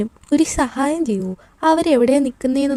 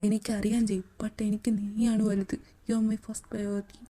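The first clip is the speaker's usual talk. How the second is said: whispered, much softer and very slow; tender whisper; 2 semitones lower, 10 dB quieter, slower than usual. Acted. Husband begging his wife to choose her own life, soft whisper. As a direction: hush it, pleading tenderly.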